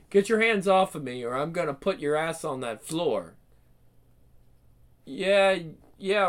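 ordinary talk

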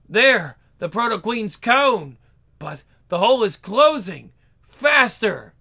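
ordinary talk

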